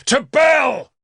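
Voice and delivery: high, loud war cry; furious shout. A narrator's reading. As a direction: scream it as the blade falls. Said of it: "I shouted".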